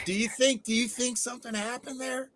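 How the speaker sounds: high, soft squeak left over from laughing; helpless laughter. Real, not acted. Guest asks if something happened there, squeaky from laughing. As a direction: squeeze the question out after a laughing fit.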